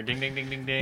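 mimicked bell dings, quiet and nasal; dinger sound. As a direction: ring out a mock game-show bell. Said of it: "I need a dinger".

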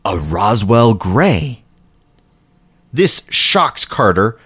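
ordinary talk

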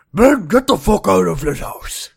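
loud, high talking dog voice; an outraged dog throwing someone out; Moose. Acted. Magic talking dog fed up with Ben, hard-pushed dog voice. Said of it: "he's just like".

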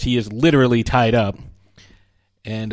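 usual speaking voice

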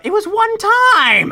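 indignant kobold. Acted. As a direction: blurt it out defensively. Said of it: "Larry sort of speaks up".

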